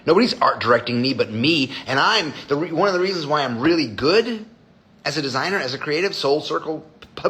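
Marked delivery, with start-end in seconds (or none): none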